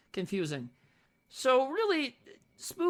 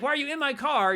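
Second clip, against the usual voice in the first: mock yell; 6 semitones higher, 5 dB louder than usual. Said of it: "he's like".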